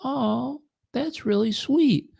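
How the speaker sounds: high, drawn-out "aww" of a wife; touched little coo. Retold or imitated. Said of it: "made this little noise".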